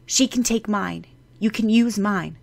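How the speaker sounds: put-on a boy's loud, eager offer; eager exclamation. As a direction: blurt it out, desperate to help. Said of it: "Eran exclaimed".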